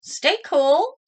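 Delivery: high answer with a rising end; eager Clark. A narrator's reading. Clark answering his teacher, high and eager. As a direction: answer brightly. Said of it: "said Clark".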